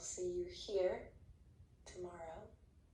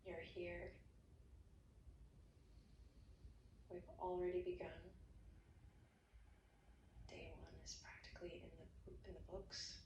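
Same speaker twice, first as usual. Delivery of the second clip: soft guided meditation; 8 dB quieter than usual. Acted. Yoga teacher ending class, hushed meditation voice. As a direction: settle them gently into rest. Closing relaxation guidance.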